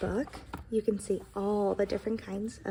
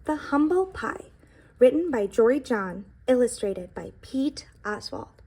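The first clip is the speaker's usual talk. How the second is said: put-on reading to children; 7 dB louder than usual. A narrator's reading. Reading the book title aloud.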